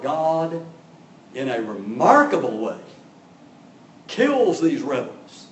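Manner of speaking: ordinary delivery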